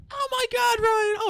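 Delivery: very high mock-shriek falsetto; hammed-up screechy girl impression; imitated outraged girl. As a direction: squeal it like a horrified girl.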